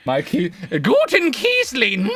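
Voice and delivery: high, slow, squeaky character voice; squeaky gleeful gremlin giggle. Acted. Character voice teasing.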